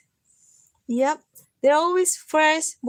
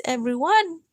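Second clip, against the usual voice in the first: ordinary delivery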